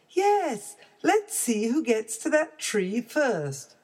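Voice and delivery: high eager little cub; excited baby bear. Acted. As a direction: race challenge, thrilled.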